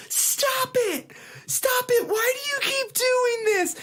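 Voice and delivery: high panicked yelling at a dog; frantic screaming. Retold or imitated. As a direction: imitate a terrified woman pleading. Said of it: "she's screaming".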